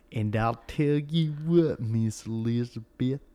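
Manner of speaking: slow, loud southern wrestler drawl; Macho Man with a southern drawl. Retold or imitated. Imitating a wrestler complaining about rivals, in a loud slow southern drawl. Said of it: "the Macho Man had a southern drawl".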